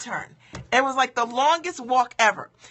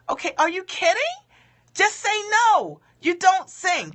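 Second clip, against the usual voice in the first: incredulous scolding; 8 semitones higher than usual. Real, not acted. Scolding her past self.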